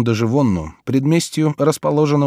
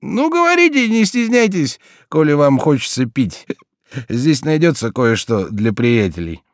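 put-on high, slurring drunk with hiccups; drunk, hiccuping; 5 semitones higher than usual. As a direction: hiccup through it, dead drunk. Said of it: "Пьяный как стелька, ... громко икая".

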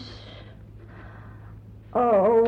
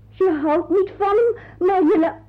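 tearful shock; 7 semitones higher than usual. Acted. Aunt repeats the girl's answer.

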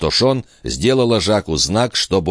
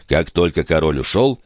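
ordinary delivery, nothing marked

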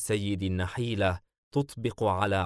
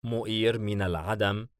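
ordinary delivery, nothing marked

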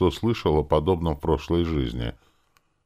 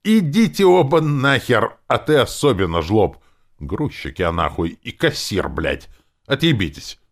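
high, loud outraged tirade; furious, emphatic she-demon rant; yelling; 8 semitones higher, 6 dB louder than usual. A narrator's reading. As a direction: scream insults at both of them. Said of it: "заорала в мозг".

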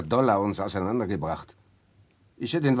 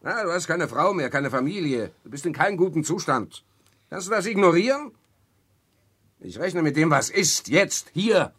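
much higher and louder throughout; furious tirade; 7 semitones higher, 6 dB louder than usual. Acted. Victor berating his brother Walter, furious raised voice. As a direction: hammer each point home.

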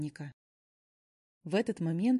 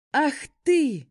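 higher, much louder furious shout; 8 semitones higher, 10 dB louder than usual. A narrator's reading. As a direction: roar it out. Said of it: "закричал оборотень".

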